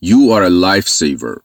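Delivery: very slow, careful English model sentence; slow teacher demo of a phrase; slow model reading. Shown as a demonstration. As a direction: spell it out slowly for learners.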